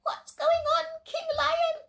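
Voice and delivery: very high, tiny rabbit voice with a laugh; little rabbit voices. Acted. The little rabbits ask their king what is happening, tiny and high. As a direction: squeak it like a small bunny. Sleepy rabbits answer the lion.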